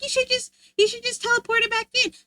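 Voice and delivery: wife imitating husband's suggestion; mock husband. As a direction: parrot the husband's advice. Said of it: "My husband's like".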